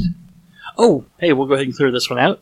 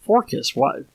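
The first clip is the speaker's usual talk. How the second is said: Forcus asked high and puzzled; surprised question; 8 semitones higher than usual. Retold or imitated. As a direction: echo the odd word in disbelief. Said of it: "she said".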